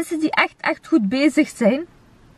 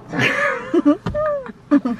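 high laugh; 12 semitones higher than usual. Real, not acted. Laugh alone. (hoge lach)

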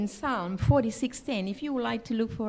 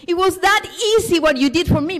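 louder, higher cry of disbelief; astonished; 6 semitones higher, 9 dB louder, faster than usual. Retold or imitated. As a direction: burst out in amazed gratitude.

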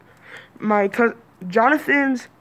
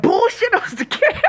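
laughing mock parent; 11 semitones higher than usual. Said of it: "They're like".

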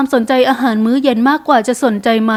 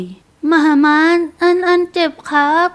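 put-on high little-boy voice, complaining of pain; small child's pitched-up ouch complaint; child's protest; 4 semitones higher, much slower than usual. A narrator's reading. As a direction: whine up to mama like a hurt four-year-old. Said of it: "จนอนาโวย".

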